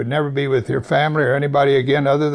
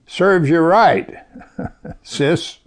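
laughing mock taunt at a sister; mock gloating; 5 semitones higher, much slower than usual. Brother gloating at his leprous sister, laughing mock taunt. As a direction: tease her through a laugh, higher. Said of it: "I don't know if she would say that".

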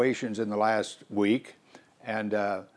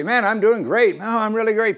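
higher, louder breezy boasting; smug and cheerful; 9 semitones higher, 5 dB louder than usual. Pretend. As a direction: brag like life couldn't be better. Imitating a smug prosperous believer.

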